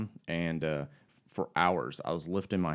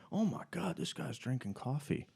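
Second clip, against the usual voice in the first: hushed, higher-pitched amazement; imitated under-breath remark; 7 semitones higher, 6 dB quieter than usual. Passing hikers remarking on the coffee drinker, imitated under their breath. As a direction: say it softly, as if not meant to be overheard. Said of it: "then under their breath".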